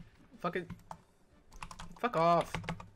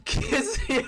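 high laughing taunt. Acted.

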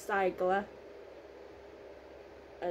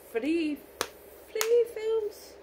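thrilled, voice jumping up high; excited; 12 semitones higher, much slower than usual. Real, not acted. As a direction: squeal three films much higher. Excited about having three films.

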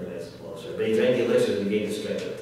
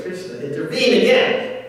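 higher-pitched quoted realisation; acted exclamation; 7 semitones higher, 3 dB louder than usual. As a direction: act it out with a raised voice. Acted quote, then repeated in own voice.

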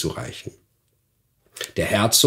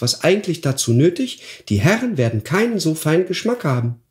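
high, bright-voiced goose consoling the dwarf; 8 semitones higher than usual. Goose Mimi comforts the weeping dwarf, high and kind. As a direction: Mimi the goose, much higher, kindly reassuring.

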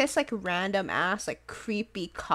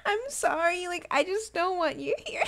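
laughing apology; 8 semitones higher than usual. Real, not acted.

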